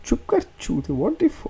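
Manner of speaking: high, exasperated retort; mock exasperation. Acted quarrel, cutting the other off.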